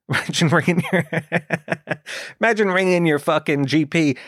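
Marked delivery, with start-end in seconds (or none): 0.1-2.4 words broken up by real laughter; giggling at the silly thought; laughing. Real, not acted. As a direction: laugh through the line. Laughing at own absurd idea.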